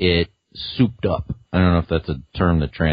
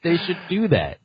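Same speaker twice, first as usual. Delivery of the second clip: laughing; 11 semitones higher than usual. Real, not acted. Laughing after the impression.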